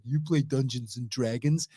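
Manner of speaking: low sneering bully voice; school bully voice. Retold or imitated. Imitating eighties school bullies.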